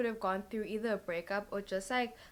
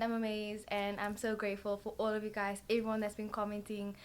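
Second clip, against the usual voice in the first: normal talking voice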